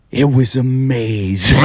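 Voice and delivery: slow, put-on awestruck drawl; drawn-out mock wonder in another man's voice; Tom Stade impression. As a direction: imitate a comic savouring it slowly. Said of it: "He goes".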